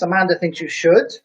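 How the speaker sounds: usual speaking voice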